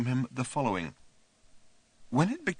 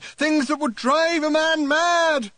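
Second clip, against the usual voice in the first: high, slow, loud shout; 9 dB louder, slower than usual. A narrator's reading. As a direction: howl it out. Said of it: "he shouted".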